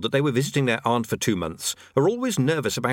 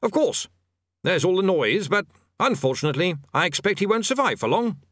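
brightened; 7 semitones higher than usual. A narrator's reading. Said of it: "He brightened up considerably".

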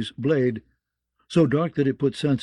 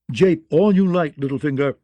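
indignant lordly bluster; 3 semitones higher, 5 dB louder than usual. Acted. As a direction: puff up and brush off the mockery. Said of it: "Lord Belmore blustered".

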